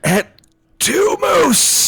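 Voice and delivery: high, shouted poetic climax; hammy theatrical shout; dramatic declaiming. Acted. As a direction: cry it out like a tragic betrayal. Declaiming alien verse at climax.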